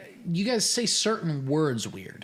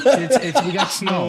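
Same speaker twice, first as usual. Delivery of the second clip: laughing imitation; 4 semitones higher, 8 dB louder, slower than usual. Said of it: "he said the same sentence three times".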